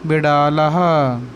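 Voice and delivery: बिडालहा slow and clear; बिडालहा धीरे-धीरे; slow teaching word. Shown as a demonstration. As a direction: draw out बिडालहा for pupils. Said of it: "क्या बोलते हैं?".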